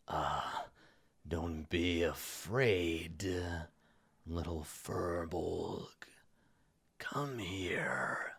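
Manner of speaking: low, very slow, sinister whisper; sickly ruler's whisper. Acted. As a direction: coax them closer in a drawn-out whisper. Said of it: "and he says".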